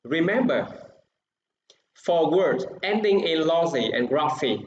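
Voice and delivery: ordinary talk, nothing marked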